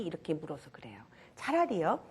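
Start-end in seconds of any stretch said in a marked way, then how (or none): 0.0-1.0 hushed aside; whispered. Real, not acted. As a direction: whisper it to the audience. Lecturer drops voice for emphasis.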